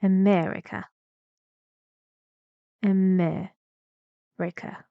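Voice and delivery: "America" stressed on MER, then dropping; teacher showing the fall in "America"; falling intonation. Shown as a demonstration. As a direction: lean on MER and fall away through "America". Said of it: "falling intonation".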